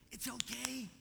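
high-pitched doting coo; silly baby talk. Acted. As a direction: comfort a toddler in exaggerated baby talk. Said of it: "you turn into an idiot".

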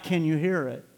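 ordinary talk

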